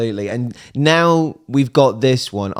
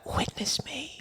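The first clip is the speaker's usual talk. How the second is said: whisper; 13 semitones higher, 16 dB quieter, slower than usual. Retold or imitated. Echoing whispered line from the track.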